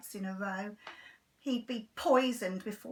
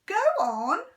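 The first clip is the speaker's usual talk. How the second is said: loud, higher push; urging; 9 semitones higher, 10 dB louder than usual. Acted. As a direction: coax him firmly. Mum urging Henry.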